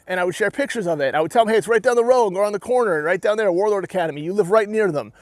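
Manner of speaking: normal talking voice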